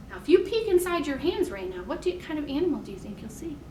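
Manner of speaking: normal talking voice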